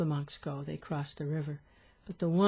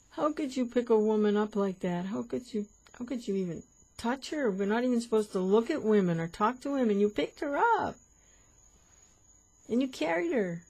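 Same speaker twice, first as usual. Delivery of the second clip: higher, pressing scolding; nagging his fellow monk yet again; indignant monk; 6 semitones higher, 3 dB louder than usual. Acted. Monk complains a third time.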